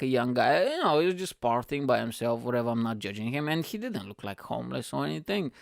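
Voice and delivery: ordinary talk, nothing marked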